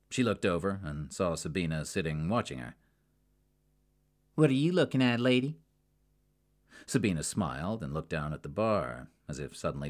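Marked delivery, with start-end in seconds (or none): 4.3-5.6 higher, louder challenge with a rising lady; confrontational. Acted. Jackie to Sabina, challenging her stare. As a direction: needle the stranger staring at her.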